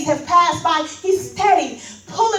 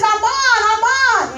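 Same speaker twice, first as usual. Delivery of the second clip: louder, higher calls of the name; desperate retold calling; calling his name; 5 semitones higher, 7 dB louder than usual. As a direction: call down to the trapped son. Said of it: "he starts to call his name".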